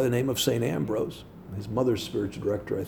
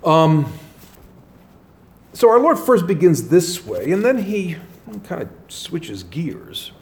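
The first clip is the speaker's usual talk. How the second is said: usual speaking voice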